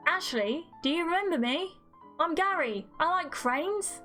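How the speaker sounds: high, careful questioning; concerned reminding. Acted. Friend reminds a supposed amnesiac who he is, earnestly. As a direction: coax her memory back, pitched high.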